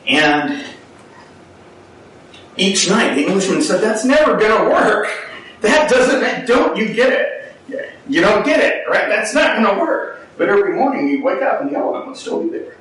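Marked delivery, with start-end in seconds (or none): 3.8-10.3 scoffing laugh. Retold or imitated. Englishman mocking the flimsy stake, laughing scornfully. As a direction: laugh off the idea as hopeless.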